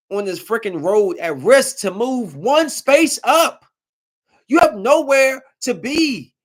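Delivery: furious venting at reckless truckers; angry rant. Real, not acted. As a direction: scold them, voice climbing. Ranting at reckless drivers.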